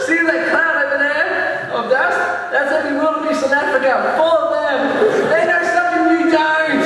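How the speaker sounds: high, urgent, exasperated warning; comic re-enacted rant; yelling at TV. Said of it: "I'm yelling at the T V".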